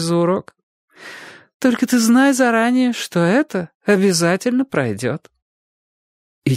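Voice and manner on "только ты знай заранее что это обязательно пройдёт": reassuring teacher. Acted.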